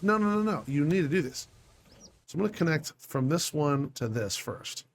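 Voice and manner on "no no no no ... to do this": insistent brain, starting high then dropping; angry inner voice. Acted. Gamer's brain overruling him, mock-angry insistence. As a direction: nag yourself as your mock-angry brain. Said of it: "It gets all angry and says".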